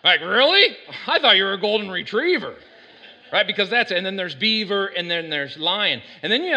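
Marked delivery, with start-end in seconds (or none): 0.2-2.5 loud, high, incredulous reaction; playful astonishment, pitched up with a laugh; mock surprise. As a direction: react in hammed-up disbelief. Acts friend's surprised reply.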